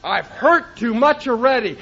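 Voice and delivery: ordinary talk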